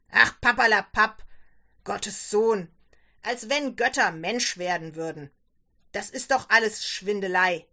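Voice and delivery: loud, scornful outburst; angry dismissal. Acted. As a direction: dismiss it forcefully and forbid it. Father angrily dismisses daughter's faith.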